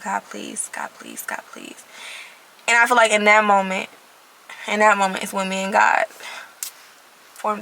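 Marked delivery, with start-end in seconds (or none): 0.0-1.8 breathy whispered plea, fading to almost nothing; whispered prayer. Retold or imitated. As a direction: whisper it like a broken last prayer. Desperate prayer, words failing her.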